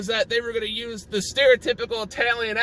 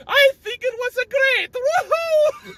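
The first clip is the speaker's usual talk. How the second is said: very high, loud bootleg Mario voice; Giuseppe; 14 semitones higher, 4 dB louder, slower than usual. Acted. Random bootleg Mario praising the commercial, high excited Italian cartoon voice. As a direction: whoop it out in falsetto Italian cartoon voice. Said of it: "voiced by Charles Martinet, goes".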